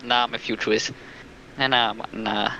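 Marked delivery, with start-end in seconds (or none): none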